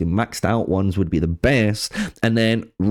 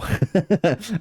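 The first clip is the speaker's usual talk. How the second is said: laughing; 7 semitones higher than usual. Real, not acted. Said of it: "I'm sorry if that goes really loud".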